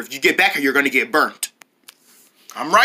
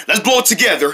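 ordinary delivery

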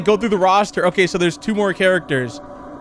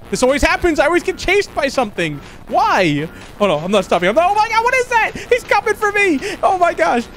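very high-pitched panicked squeal; 12 semitones higher than usual. Real, not acted. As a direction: build into a high frantic cry as you're chased. Being chased by a monster.

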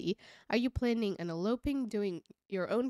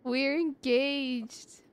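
high, slow gushing announcement; excited daughter telling mom; voicing Madeline to her mom; 8 semitones higher, slower than usual. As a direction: squeal the news to mom, drawn out. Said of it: "announce the engagement to our mom and be like".

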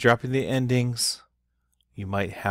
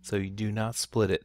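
ordinary talk, nothing marked

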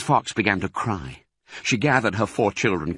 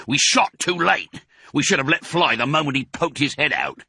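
higher-pitched, exasperated farmer voice; frustrated farmer Boggis; 7 semitones higher than usual. A narrator's reading. Boggis to the other farmers, exasperated. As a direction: grumble about the missed shot, pitched up. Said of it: "said Boggis".

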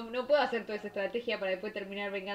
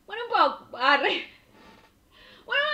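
higher and louder playful concession; mock giddy giving in; 7 semitones higher, 9 dB louder than usual. Real, not acted. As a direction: squeal a little as you give in. Admitting she'd let him pay. (cediendo con entusiasmo, agudo y fuerte)

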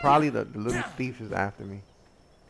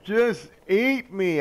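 exhausted surrender; 8 semitones higher, 6 dB louder, much slower than usual. Retold or imitated. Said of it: "I'd be like".

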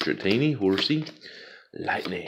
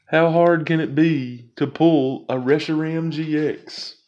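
whiny plea; 7 semitones higher than usual. Real, not acted. Whining for a wanted card.

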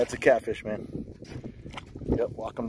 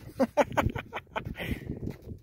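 high breathless laugh; 5 dB quieter than usual. Real, not acted. Fish on the line.

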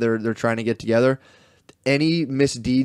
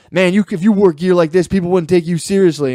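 loud, raised imitation of a complaint; mock aggrieved student; 6 semitones higher, 6 dB louder than usual. Student retells man in do-rag objecting, loud mock protest. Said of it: "And he was like".

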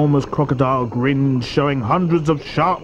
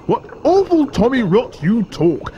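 high, incredulous crocodile outburst; indignant cry; 13 semitones higher, slower than usual. A narrator's reading. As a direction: scoff in outraged disbelief, pitch soaring. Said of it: "cried the enormous crocodile".

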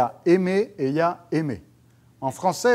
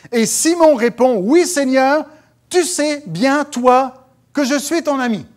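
high, loud declamation; scripture proclamation; 9 semitones higher, 8 dB louder than usual. Acted. Preacher reads Simon's answer to Jesus, loudly proclaimed. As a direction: proclaim each phrase in a raised voice. Reads Bible passage aloud.

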